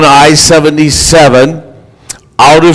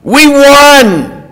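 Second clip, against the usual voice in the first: high-pitched, drawn-out victory cry; enthusiastic; 9 semitones higher, much slower than usual. Retold or imitated. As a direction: imitate jubilant fans announcing a win. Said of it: "with an enthusiasm in their voice".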